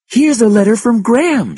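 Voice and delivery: high, loud cry of alarm; alarmed, high-pitched exclamation. A narrator's reading. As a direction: call it out in panic. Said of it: "she cried".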